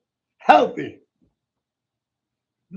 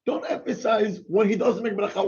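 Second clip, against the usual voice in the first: normal talking voice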